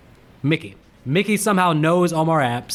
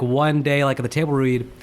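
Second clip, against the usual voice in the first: ordinary talk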